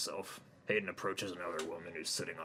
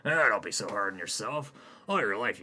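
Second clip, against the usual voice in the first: doctor voice; 3 semitones higher, 10 dB louder than usual. Acted. Doctor mocks Hayden.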